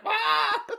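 high laughing shriek of panic; mock scream. Hazed boot freaking out, a laughing shriek. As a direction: yelp like a boot getting hazed.

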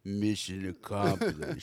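imitating Rambo's movie line; gruff Rambo quote. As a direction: deliver it like an action hero.

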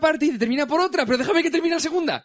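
high, insistent, exasperated voice; exasperated lecture to an interrupter, very high; exasperated plea. Real, not acted.

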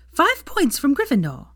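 high, loud call across the crowd; sudden shout. A narrator's reading. Teacher catching a boy pulling pigtails, shouting. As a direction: bark it at the boy.

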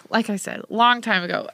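ordinary talk, nothing marked

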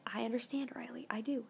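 hushed, gentle retelling of a friend's comfort; quiet, tender words to a crying friend; soft comforting whisper. Retold or imitated. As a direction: whisper it like consoling someone in tears. Friend comforting her, retold.

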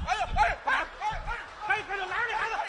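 laughing through fake mourning cries; mock wailing. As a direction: cry out for a dead father, half in jest. Son wailing at mock funeral.

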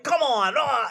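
hammed-up high yell of a bad actor; mock actor yell. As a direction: shout it like a corny sports-movie actor. Football scene actor badly acted.

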